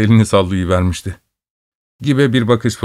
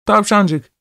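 put-on high, eager little boy; child voice; 10 semitones higher than usual. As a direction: call the bunny like a small child. Child calls the rabbit.

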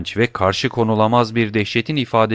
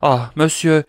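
frightened cry; 6 semitones higher, 3 dB louder than usual. Acted. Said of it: "diye haykırdı".

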